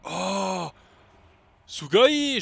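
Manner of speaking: high, loud anime squeal; excited anime fan. Real, not acted. Boss wakes, gamer shouts Japanese.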